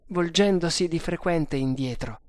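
usual speaking voice

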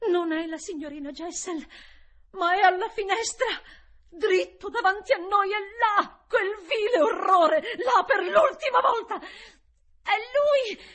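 high shouting, building in force; loud, impassioned alarm; frantic outcry. A narrator's reading. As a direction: cry out the horror, rising to a scream. Governess cries out at the apparition.

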